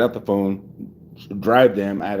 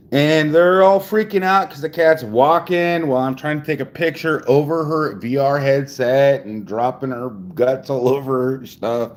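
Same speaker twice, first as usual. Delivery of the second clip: ordinary talk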